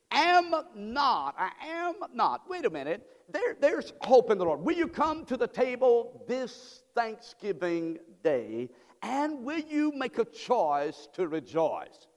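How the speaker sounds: rising, slow preacher exhortation; preaching. Real, not acted. Pastor urging the church to hope, rising. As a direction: lift the congregation with hope.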